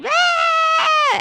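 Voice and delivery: high, loud exasperated Ah; frustrated yell. Retold or imitated. Mom showing how she yells at her kids each morning, exasperated shout. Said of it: "every single morning".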